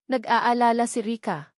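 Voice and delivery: plain reading voice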